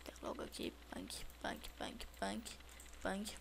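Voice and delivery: unvoiced, soft murmur to self; whispering. Real, not acted. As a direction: whisper it under your breath. Muttering while playing.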